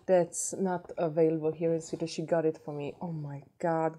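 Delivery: ordinary delivery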